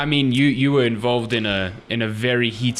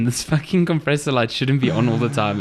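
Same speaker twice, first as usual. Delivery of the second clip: ordinary delivery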